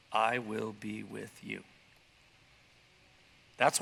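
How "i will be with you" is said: lower, softer and slow promise; solemn God voice. Retold or imitated. God promising Gideon his presence, low, soft and slow. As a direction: speak the promise gently and slowly.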